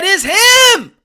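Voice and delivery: high, loud joyful cry, slowing down; mock emotional outburst; overjoyed fans. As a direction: near tears of joy at seeing him. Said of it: "We said".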